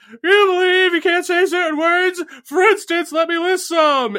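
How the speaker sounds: very high, loud stand-up impression; Jerry Seinfeld. Comic complaining to a crowd about banned words, in a high mock Seinfeld voice. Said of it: "Jerry Seinfeld just be like".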